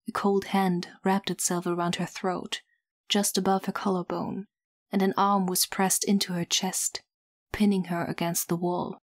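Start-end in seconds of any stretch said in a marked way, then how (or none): none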